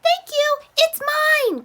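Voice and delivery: high, loud duck voice; quacking duck voice. Acted. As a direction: quack the words like a proud duck. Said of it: "quacked Duck".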